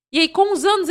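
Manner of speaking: ordinary delivery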